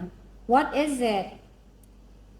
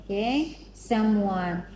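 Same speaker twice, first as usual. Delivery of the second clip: normal talking voice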